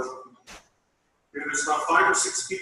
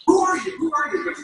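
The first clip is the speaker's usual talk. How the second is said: higher, louder confused boss on the phone; confused demanding; 12 semitones higher, 6 dB louder than usual. Retold or imitated. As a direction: mimic the woken boss demanding who he is. Supervisor repeats question on phone.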